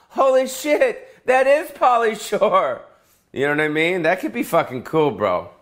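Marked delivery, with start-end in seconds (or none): 0.1-2.8 high, slow stunned gasp; astonished tourist. Retold or imitated. Tourist stunned by the living wax figure, high and slow. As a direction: drag it out in shocked disbelief. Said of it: "And then I'll be like".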